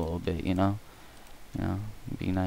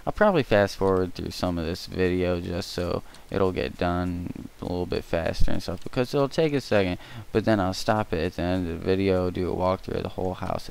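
ordinary delivery

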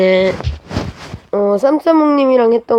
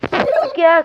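high squeak; 11 semitones higher than usual. Acted.

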